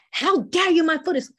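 outraged protest. Shown as a demonstration. Teacher showing outrage at cold food. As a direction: push forward into their face. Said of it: "So it's".

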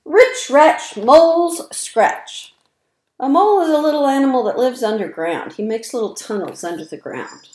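bouncy, sing-song rhyming read-aloud; reading to children. A narrator's reading. Reading rhymes to young children, lively sing-song. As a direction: punch each rhyme for little listeners.

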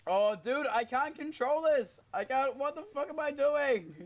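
high, drawn-out wail of frustration; exasperated cry. Real, not acted. Player losing control of the car, exasperated cry. Failing at the game.

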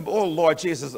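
usual speaking voice

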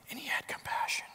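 whispered, hushed. Real, not acted. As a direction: drop to a whisper to move them. Sermon's tender point.

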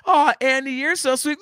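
high, loud gushing coo; cooing sweet voice. Retold or imitated. Imitating someone thanked for flowers, high loud coo. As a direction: gush like a touched sweetheart. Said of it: "I put 'em on the table".

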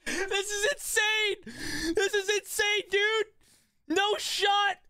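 high, drawn-out, awed exclaim; stunned excitement. Real, not acted. Amazed at the result.

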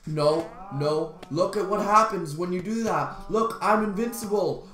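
normal talking voice

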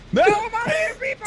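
high, fast yelp; panicked shout. Real, not acted. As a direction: blurt it out in a panic. Panicked outburst in game.

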